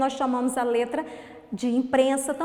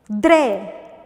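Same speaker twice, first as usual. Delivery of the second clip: "Dré" loud, lower and drawn out; isolated syllable; 4 semitones lower, 6 dB louder than usual. Shown as a demonstration. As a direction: sound out Dré on its own. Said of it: "segunda sílaba". (Dré, sílaba isolada e forte)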